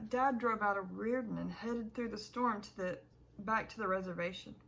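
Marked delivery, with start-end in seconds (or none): none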